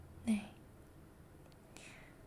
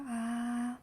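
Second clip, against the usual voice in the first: demo ah sound. Shown as a demonstration. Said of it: "아 소리 내, 내볼게요".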